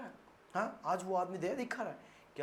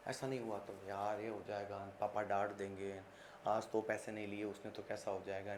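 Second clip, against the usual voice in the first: low, much softer fretful voice; anxious worrying; 8 semitones lower, 11 dB quieter than usual. Acted. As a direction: brood over it nervously. (चिंतित धीमी बड़बड़ाहट)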